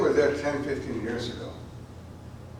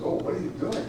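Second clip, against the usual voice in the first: mock whisper; 7 semitones higher than usual. Mocking the media's question.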